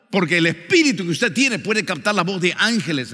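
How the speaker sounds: insistent pulpit delivery, high and forceful; fervent preaching. Real, not acted. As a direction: press the warning on the congregation. Preaches and proclaims scripture.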